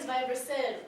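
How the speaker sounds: ordinary talk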